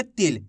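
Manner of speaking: "तिल" said swiftly with a short i; quick short vowel. Shown as a demonstration. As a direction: say "तिल" fast, no stretch on the vowel. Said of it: "तेजी के साथ इसका उच्चारण".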